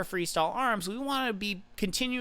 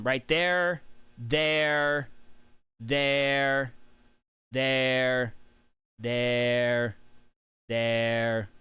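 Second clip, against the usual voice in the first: slow, spaced there, sinking lower each time; pointing out each stop; 6 semitones lower, much slower than usual. Shown as a demonstration. Coach pointing at each stop on video, spaced out. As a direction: mark every hesitation with a drawn-out there. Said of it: "let me show you the hesitation".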